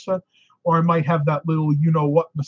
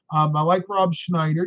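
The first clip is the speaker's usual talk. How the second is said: ordinary delivery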